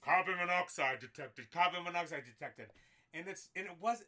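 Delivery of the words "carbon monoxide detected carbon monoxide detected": loud, robotic alarm chant; smoke alarm voice. Retold or imitated. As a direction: blare it like a shrieking alarm. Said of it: "the ear-piercing sound of the carbon monoxide detectors".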